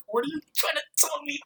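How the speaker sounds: mock shocked Scott; imitating Scott. Vlogger imitating Scott questioning Wallace, high and soft.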